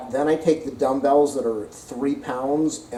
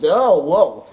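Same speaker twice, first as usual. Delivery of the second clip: sudden startled cry, a bit higher and louder; startled whoa; 4 semitones higher, 7 dB louder than usual. Real, not acted. Losing balance on the ball, startled whoa. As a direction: caught off guard as the ball wobbles.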